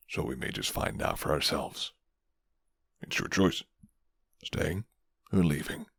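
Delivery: low, soft-spoken rough stranger; a hint of a guarded werewolf offering shelter; gruff werewolf man. Werewolf warns of full moons.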